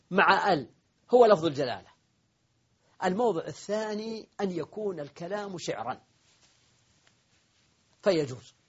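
ordinary talk

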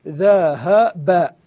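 "ذهب" very slowly as three separate voweled syllables, dha, ha, ba. Shown as a demonstration. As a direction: spell out each vowel of "ذهب", drawn out. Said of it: "الأصل في ذهبت". ("ذهب" مقطعة ببطء: ذَ، هَـ، بَ)

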